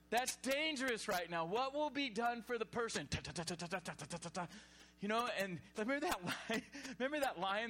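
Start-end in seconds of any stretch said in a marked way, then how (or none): 3.1-4.5 rapid even tatter of a stick on bars; imitated rattling. Shown as a demonstration. Preacher miming a stick beating the cage, rapid-fire rattle.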